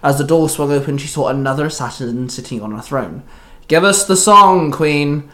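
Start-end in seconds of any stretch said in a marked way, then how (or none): 3.7-5.2 loud, drawn-out demand, then easing; barked threat. A narrator's reading. Assassin demands the song from the queen, barking. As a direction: bark the opening demand slowly. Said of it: "the assassin barked".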